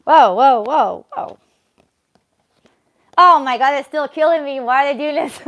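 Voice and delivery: tarot cards keep stalling, exasperated exclamation; mock exasperation. Real, not acted. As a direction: exclaim in playful disbelief. Cards keep delaying answer.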